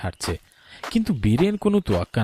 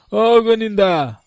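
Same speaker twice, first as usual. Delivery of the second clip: calling out; 11 semitones higher, 6 dB louder than usual. Acted. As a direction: call him awake from outside. Bidhan calls Gunin awake.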